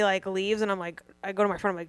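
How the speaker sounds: normal talking voice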